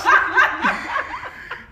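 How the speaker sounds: high squealing laughs about the son; high laughter. Real, not acted. Laughing at son's antics.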